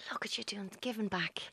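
soft unvoiced whisper. Real, not acted. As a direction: whisper it teasingly. Teasing aside.

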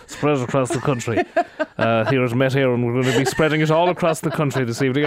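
mock Irish radio host voice; Irish accent. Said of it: "And then you're like".

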